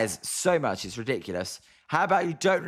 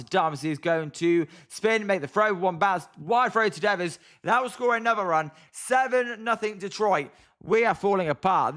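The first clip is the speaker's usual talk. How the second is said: excited play-by-play; 6 semitones higher than usual. Acted. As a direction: call the hit like a fan-announcer losing it. Play-by-play of throwing error.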